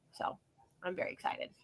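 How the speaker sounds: breathy whispered aside. Real, not acted. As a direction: whisper it under your breath, very excited.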